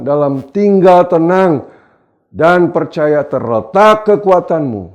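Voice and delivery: preacher's ringing verse reading; scripture declaimed. Real, not acted. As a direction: proclaim the verse at full force.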